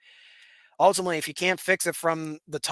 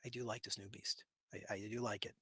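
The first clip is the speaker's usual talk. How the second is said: soft aside to laptop; 8 semitones lower, 19 dB quieter than usual. Real, not acted. Talking softly to his new laptop.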